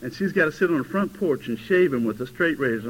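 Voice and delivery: ordinary delivery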